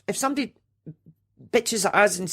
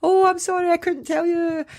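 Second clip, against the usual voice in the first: high-pitched, hammed-up breakdown apology; wailed apology, acted out; mock tearful confession; 8 semitones higher than usual. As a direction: imitate someone breaking down as they confess. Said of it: "broke down crying and said".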